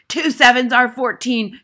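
furious full-volume headmistress; Trunchbull bellow. A narrator's reading. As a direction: bellow the sum at a pupil. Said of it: "Miss Trunchbull bellowed".

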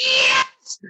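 very high, squealed celebration; high-pitched burst of mock-hysterical joy; ecstatic cheer. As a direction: let out a reenacted victory yell. Reliving his joy at the news.

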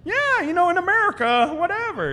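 very high, airy and offhand; a mock-modest shrug in a high voice; offhand brag. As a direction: toss it off casually. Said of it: "I'm like".

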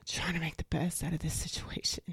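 breathy whisper, no voice; hushed repeat to yourself; whispered aside. Real, not acted. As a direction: whisper it like a private mantra.

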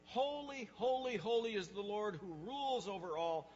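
high, loud proclamation of praise; ringing cry, each phrase proclaimed; calling out. Acted. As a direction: call it out like heavenly beings circling the throne. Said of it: "they're calling around, calling out".

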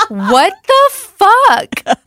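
high, slow, stretched out disbelief; shocked reply. Retold or imitated. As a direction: drag it out in shock. Daughter texting back shocked.

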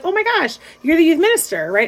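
lightly excited, imitating a surprised lady; retold surprise. Lady excited to meet youth minister.

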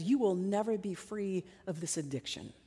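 low, hushed, sinking inner voice; quiet, heavy verdict of hopelessness; voice of condemnation. Acted. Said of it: "This is the voice that says".

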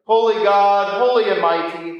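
normal talking voice

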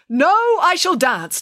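emphatic declaration; declared. A narrator's reading. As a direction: plant your feet and insist on dancing. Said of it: "declared Morgiana".

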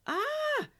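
very high, loud startled scream; short storybook shriek of fright. A narrator's reading. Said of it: "her sister screamed".

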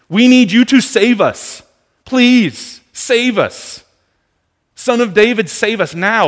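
high, loud, desperate crying out; desperate scream. Retold or imitated. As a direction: beg to be saved at full voice. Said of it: "literally screaming in desperation".

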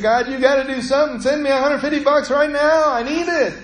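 a touch of frantic begging, imitated; desperate prayer. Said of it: "And I just prayed".

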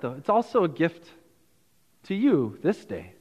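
usual speaking voice

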